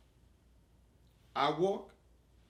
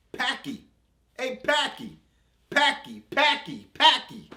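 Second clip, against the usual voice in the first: much higher, loud taunting yells; shouting a name; 12 semitones higher, 9 dB louder than usual. Retold or imitated. Schoolkids calling after him, loud high taunting shouts. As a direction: call it out like kids across the playground. Kids shouting across street.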